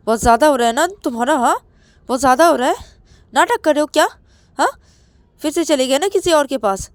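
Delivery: high, loud screaming threats and insults; jealous partner screaming threats over the phone; furious tirade. Acted. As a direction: tear into him, ending with the insults. Ravi threatens and insults Rahul.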